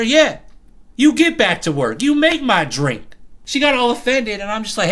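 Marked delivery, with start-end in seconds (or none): 0.0-3.1 firm retort. Retold or imitated. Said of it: "I tell her".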